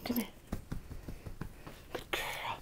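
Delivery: normal talking voice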